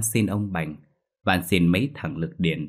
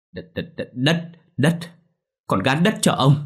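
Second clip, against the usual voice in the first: crying out, higher and louder at the end; 6 semitones higher, 4 dB louder than usual. Acted. Beaten debtor giving up his land, wailing cry. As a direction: wail the words out in despair. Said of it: "anh nói như gào lên".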